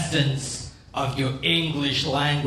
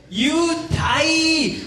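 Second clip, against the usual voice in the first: very high, drawn mock shout; Korean gangbanger threat; 12 semitones higher than usual. Korean gangbanger with portable fan, high mock-menacing shout. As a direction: yell a comic threat, pitched way up. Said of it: "they come up to you and say".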